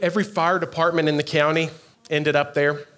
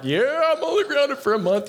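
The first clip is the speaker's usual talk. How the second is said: happy dance; 9 semitones higher than usual. Retold or imitated. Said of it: "could have done the happy dance".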